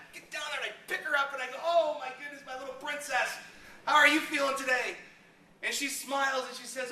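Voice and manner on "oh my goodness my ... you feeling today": higher, bright doting greeting; cheerful dad. Retold or imitated. Dad greeting his daughter, mock gushing and higher. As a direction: gush over your little princess.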